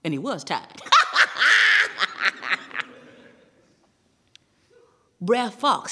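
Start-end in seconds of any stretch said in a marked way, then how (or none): 0.9-2.8 loud, very high cackle; laughing fit. A narrator's reading. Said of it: "Laughing fit to be tied".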